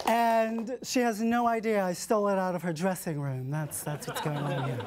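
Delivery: ordinary talk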